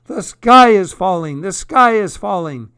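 high, loud panicked cry; clucked alarm. A narrator's reading. Hen cuts off the narrator's warning, clucking in alarm. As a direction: burst in over the other speaker. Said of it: "clucked Chicken Licken".

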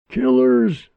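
high, loud accusing scream. A narrator's reading. Angry woman screams at Wilma on the porch, high and loud. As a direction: scream it in her face. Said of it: "the woman was screaming".

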